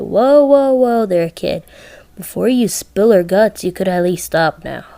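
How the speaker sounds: put-on loud whoa, whoa, pulling a kid back; Chucky calling out. Chucky stopping the kid mid-beating, loud then easing. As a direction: shout him down, then ease off. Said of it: "And Chucky said".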